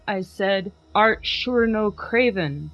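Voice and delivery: plain reading voice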